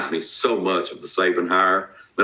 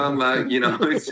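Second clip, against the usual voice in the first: ordinary talk, nothing marked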